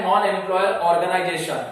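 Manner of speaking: usual speaking voice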